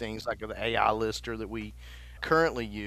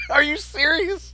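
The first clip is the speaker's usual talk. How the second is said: laughing; 7 dB louder than usual. Real, not acted. Laughing disbelief.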